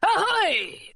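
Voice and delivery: high, drawn-out hail; long falling sailor's call; pirate's cry. A narrator's reading. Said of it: "cried the pirate again".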